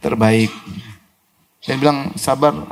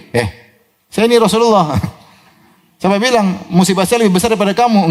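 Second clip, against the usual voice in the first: higher-pitched, lightly indignant retort; mock indignation; 5 semitones higher than usual. Preacher imagining a reply the Prophet never gave, mock indignation. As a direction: play at pulling rank, half laughing. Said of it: "Nabi tidak marah digituin".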